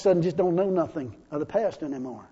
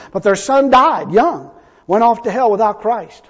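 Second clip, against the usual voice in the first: loud, high, emphatic preacher; raised pulpit voice, punching the key words; preaching; 5 semitones higher, 9 dB louder than usual. Real, not acted. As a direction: preach it out with full force. Preaches at full force.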